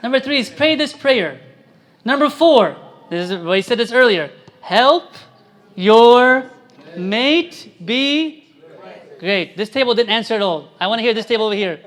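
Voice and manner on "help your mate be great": slow, spaced words with rising pitch; word-by-word prompt. Shown as a demonstration. Preacher prompting the tables to recite point four, word by word. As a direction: draw out each word for the group to join in.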